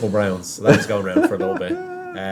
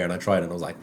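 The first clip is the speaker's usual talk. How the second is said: normal talking voice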